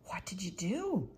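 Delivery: urgent hushed question; whispered demand. Acted. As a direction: ask it in an eager whisper in the dark. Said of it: "Thomas demanded".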